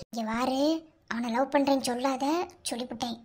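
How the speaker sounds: low, soft secret instructions; whispering in her ear. Acted. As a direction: murmur it right into her ear. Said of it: "காதுல ஓதிக்கிட்டு இருக்கா". (காதில் கிசுகிசுப்பு)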